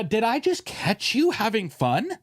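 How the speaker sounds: drawn-out mock accusation; girlfriend quote. Imitating a girlfriend catching her man gaming, slow and teasing. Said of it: "That's what the girlfriend says".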